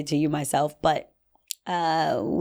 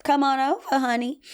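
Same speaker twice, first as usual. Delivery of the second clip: coy, higher, playful come-on; flirty invitation; 8 semitones higher than usual. Shown as a demonstration. To a man across the room, flirty call. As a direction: beckon him over sweetly. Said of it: "your feminine way to say".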